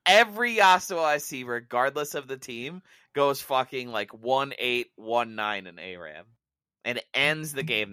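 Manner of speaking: loud, heated ranting. Real, not acted. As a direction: rant at full volume. Rant about Yasuo players.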